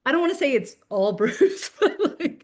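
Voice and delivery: real laughter breaking into the sentence; laughing. Real, not acted. Author sharing a cherished family memory, laughing.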